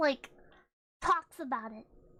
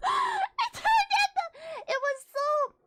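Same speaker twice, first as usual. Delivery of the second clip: emphatic exclaim; 12 semitones higher, slower than usual. Real, not acted.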